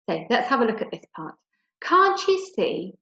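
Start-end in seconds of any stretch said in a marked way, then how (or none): 1.8-3.0 pleading cry. Acted. Pleading girl quoted again.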